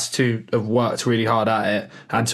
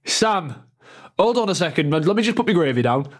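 higher-pitched comic northern voice; generic northern accent; 4 semitones higher than usual. Acted. Manager on the phone to the player, mock northern accent. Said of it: "I'm doing a generic northern accent".

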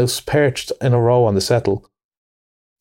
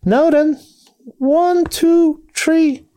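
put-on very high young field mouse voice; young carol leader; much slower than usual. A narrator's reading. Elder field mouse counts the carollers in, high and piping. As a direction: pipe up with a high, measured count-in. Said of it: "one of the elder ones that carried the lantern was just saying".

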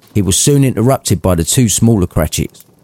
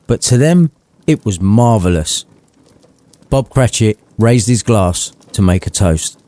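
ordinary delivery, nothing marked

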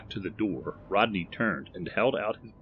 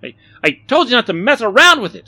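loud, high, rushed stammering scold; angry scolding; 14 dB louder, faster than usual. A narrator's reading. Barry scolds Rodney over the broken globe, angry. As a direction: burst out at whoever caused the breakage.

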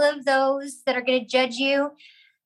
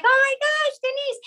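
high-pitched retold squeal of recognition; delighted surprise; 14 semitones higher than usual. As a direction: greet an unexpected friend, much higher voice. Retold surprise reunion with colleague.

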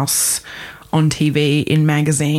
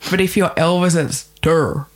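[name] drawl. Shown as a demonstration. Host imitating how [name] says tour. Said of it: "the word tour".

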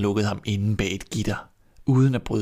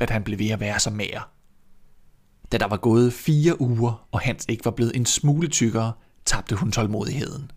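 ordinary delivery, nothing marked

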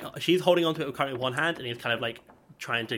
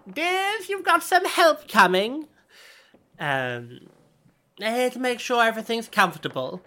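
high sweet granny calling upstairs; old granny voice; 11 semitones higher, 8 dB louder than usual. Acted. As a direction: call up like a doting grandmother.

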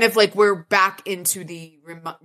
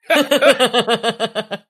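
rapid chuckling burst, pitched up; laugh; 5 semitones higher, 4 dB louder than usual. Real, not acted. Reader amused after Murphy's complaint, a real laugh. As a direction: break into a real, raised laugh.

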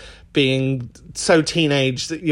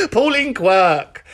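laughing out a name; 4 semitones higher, 4 dB louder, much slower than usual. Real, not acted. Names the actress she sounds like.

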